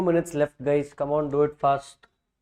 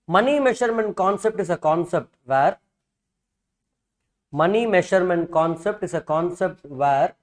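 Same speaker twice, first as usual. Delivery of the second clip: dictating. Shown as a demonstration. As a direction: read it out phrase by phrase for notes. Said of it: "let me dictate the same thing".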